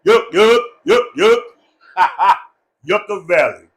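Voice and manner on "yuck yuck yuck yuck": higher, louder comic yucks; mock disgust. Speaker joking about Yucca Valley's name, mock disgusted. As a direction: bounce each yuck out for laughs.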